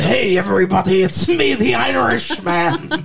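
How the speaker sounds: hammed-up Irish brogue, last word dragged out; bad Irish accent. Co-host mocking an actor's poor accent, hammed-up fake Irish. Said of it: "If he did a better Irish accent".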